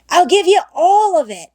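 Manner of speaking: put-on a boy eagerly giving in; Ben, eager. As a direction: blurt out the whole offer. Ben's final eager offer.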